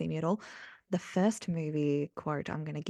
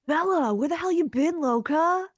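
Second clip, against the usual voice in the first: high, loud cheesy movie-quote voice; hammed-up teen-film hero; Jacob; 9 semitones higher, 8 dB louder than usual. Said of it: "when Jacob is running up to Bella".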